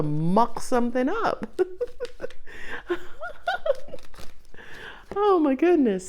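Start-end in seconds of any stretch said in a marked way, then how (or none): 1.7-4.9 high, breathless giggling laugh; long laugh. Real, not acted. Reader tickled by the perfectly timed message, long high giggle. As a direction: laugh it out softly and high-pitched.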